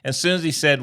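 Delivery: usual speaking voice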